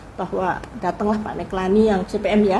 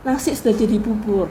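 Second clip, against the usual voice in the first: ordinary delivery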